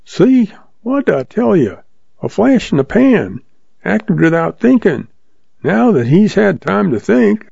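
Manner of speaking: high, sneering townsman voice; a mocking bystander jeering, harsh. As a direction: spit it out with harsh scorn. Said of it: "Someone said harshly".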